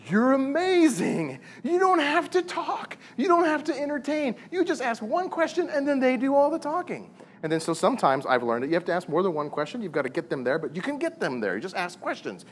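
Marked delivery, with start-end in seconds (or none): 0.1-7.1 high, slow, admiring exclamation; awed praise. Retold or imitated. Husband tells his wife she's amazing, high and awed. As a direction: gush over her in a much higher voice. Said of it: "I walked away and I said".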